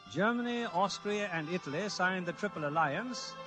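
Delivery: usual speaking voice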